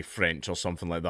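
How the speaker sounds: ordinary talk, nothing marked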